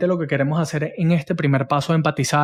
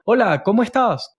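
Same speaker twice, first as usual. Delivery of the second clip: pitched-up, bright sales greeting with upward inflection; high upward salesy tone; 6 semitones higher than usual. Shown as a demonstration. Coach demoing the wrong salesman greeting, high and upward. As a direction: sound like a pushy insurance agent, voice high. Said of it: "Inflexiones de voz hacia arriba, hablan agudo".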